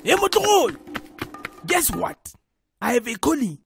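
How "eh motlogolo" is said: high-pitched call to get attention. Acted. Uncle calling his nephew over with news, calling out.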